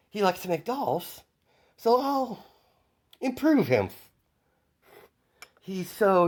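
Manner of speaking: high, sing-song sinister glee; gleeful villain. Acted. Villain Izuku gloating to Toga over the doctor, high and sinister. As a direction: gloat like a smiling mad villain.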